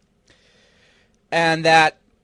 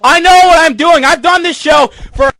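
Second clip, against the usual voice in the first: raised, higher voice, insisting he knows what he's doing; angry insistence; 6 semitones higher than usual. Real, not acted. Host insisting to his dad he knows the show, angry. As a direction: push back hard, pitch climbing. Arguing with dad over the mic.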